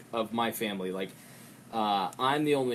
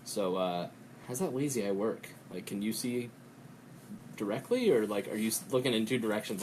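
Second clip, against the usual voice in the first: tentative asking; 4 semitones lower, 6 dB quieter than usual. Retold or imitated. Acting out awkward, tentative question.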